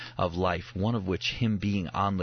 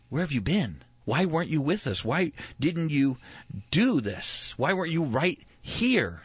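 higher, exasperated questions from a worried mother; mock parental scolding; 5 semitones higher than usual. Parents scolding young Jesus, mock exasperated. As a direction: scold the boy like a frantic parent, half-laughing by the end.

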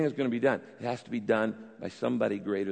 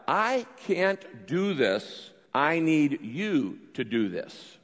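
prayer; 3 semitones higher, much slower than usual. Shown as a demonstration. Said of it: "I tell God".

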